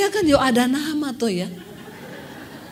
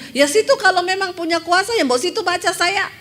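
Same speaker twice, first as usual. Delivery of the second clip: rude demand; 6 semitones higher, 5 dB louder than usual. Retold or imitated. Rude woman challenging the preacher.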